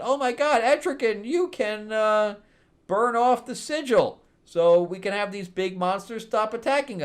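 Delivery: high, loud excited plea; excited hero. Retold or imitated. Heroes urging Etrigan to burn the sigils, high and excited. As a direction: squeal it like a panicked hero with an idea.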